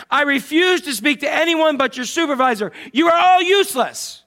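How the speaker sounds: high, loud mock tirade; all-capitals yelling. Irate caller rejects the stand-in supervisor, acted yelling. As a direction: act out a caller building to a full shout. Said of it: "Again, all capitals".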